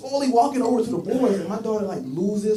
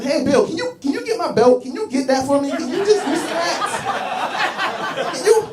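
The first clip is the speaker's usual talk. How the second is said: much higher, sing-song voice asking a buddy; mock delicate plea, pitched way up; girly-voiced guy; 9 semitones higher, slower than usual. Guy asks his buddy in the car to loosen his belt, high mock-girly voice.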